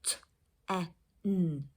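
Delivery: T, E, N as separate phonemes; sounding out. Shown as a demonstration. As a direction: sound out "T, E, N" with gaps between. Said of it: "write ten first".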